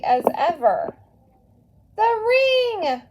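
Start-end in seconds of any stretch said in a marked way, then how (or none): 2.0-3.0 high, loud excited cry; near scream. A narrator's reading. Maria spots the ring on her mother's finger, near scream. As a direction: burst out at the sight of the ring. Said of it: "Maria nearly screamed".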